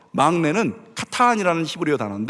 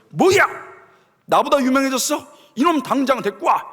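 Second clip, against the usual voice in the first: enraged King Saul; 7 semitones higher than usual. Acted. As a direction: roar the order like a jealous king. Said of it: "사울이 화가 나는 거예요". (화가 난 사울의 고함)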